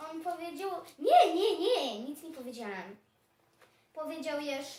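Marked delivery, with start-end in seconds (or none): 1.0-3.0 hasty denial. Acted. Dog to challenging hedgehog, loud hasty denial easing off. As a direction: burst out denying it, then ease off. Dog hastily denies having spoken.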